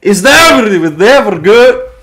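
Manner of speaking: loud, high hammed-up Scottish imitation; exaggerated teasing Scottish echo; mock Scottish accent. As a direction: mimic a Scottish accent, pitched right up. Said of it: "I'm turning Scottish".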